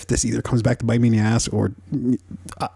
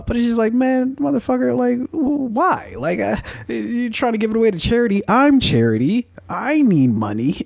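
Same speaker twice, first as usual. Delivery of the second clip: put-on higher, pleading broke-kid voice; whiny kid; 12 semitones higher than usual. Son begging his rich dad not to give it away, whiny higher voice. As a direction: beg Dad for the money, half joking. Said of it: "that's what I would be probably saying".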